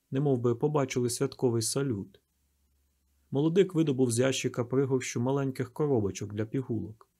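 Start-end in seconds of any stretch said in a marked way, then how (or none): none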